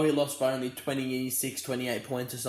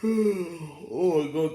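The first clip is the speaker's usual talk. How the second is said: talking through a yawn; slow, stretched yawn; 4 semitones higher, 4 dB louder, much slower than usual. Real, not acted. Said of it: "sorry about all the yawning".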